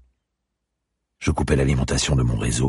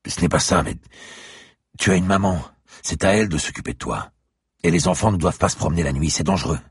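higher, louder stern adult lecture; raised lecturing; 7 semitones higher, 3 dB louder than usual. Acted. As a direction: lay down the rules to a child, insistent. Franck lectures the girl.

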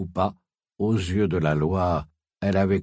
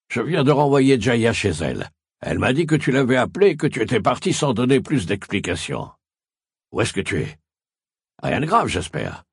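anxious man calling, a bit higher and louder; worried caller; 4 semitones higher, 4 dB louder, faster than usual. A narrator's reading. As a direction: press her with worried questions. Caller voiced in the story.

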